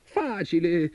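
high, lightly amused boast; amused tailor. A narrator's reading. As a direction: toss it off high and cocky. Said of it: "rise il piccolo sarto".

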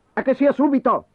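high, bossy order; commanding. Acted. Boy ordering his mother to feed him, bossy. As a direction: demand it like a little tyrant. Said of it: "comandò".

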